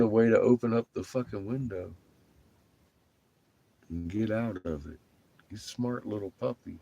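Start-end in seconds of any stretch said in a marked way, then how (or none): none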